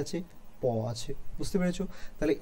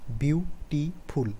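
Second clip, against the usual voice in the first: slow, syllable by syllable beautiful; slow syllable demo; much slower than usual. Shown as a demonstration. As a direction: break beautiful into beats. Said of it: "যেমন টো".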